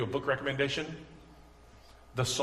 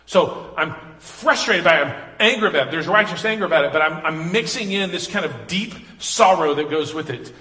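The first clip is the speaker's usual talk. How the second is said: louder, pressing sermon delivery; fiery preaching; 3 semitones higher, 8 dB louder than usual. Acted. Pastor explaining righteous anger to congregation, fiery and loud. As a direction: preach it with full force. Preaching lament with rising force.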